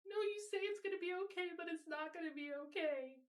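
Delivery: put-on distressed teenager begging her mom, pitched well up; crying teen girl. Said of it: "Annie is crying to her mom".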